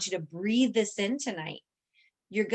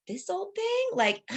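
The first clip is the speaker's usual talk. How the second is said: put-on higher, coy this old thing; brushing off praise, coy and high; mock modest brush-off; 10 semitones higher than usual. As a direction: shrug off praise coyly. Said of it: "you dismiss yourself".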